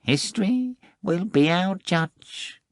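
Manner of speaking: high, slow, softly said cat line; a cat settling the matter calmly; quiet cat. Acted. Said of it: "he just said quietly".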